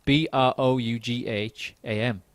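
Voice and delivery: B R O U G H A M spelled letter by letter, slow; each letter of B R O U G H A M said apart. Shown as a demonstration. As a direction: spell out B R O U G H A M slowly. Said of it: "which is spelled".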